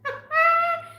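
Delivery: short high laugh; high squealing laugh. Real, not acted. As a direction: squeal with delight at the pet. Laughing at her pet.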